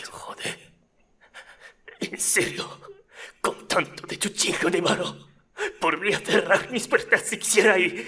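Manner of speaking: rough and emotional, building up high; snarky audition retake. Acted. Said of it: "I forgot to change my pronunciation of cerrar".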